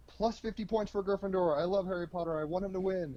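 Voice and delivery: high-pitched, cheering benevolent headmaster; Dumbledore. Acted. Headmaster awarding house points, high and encouraging. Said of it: "Dumbledore from Harry Potter".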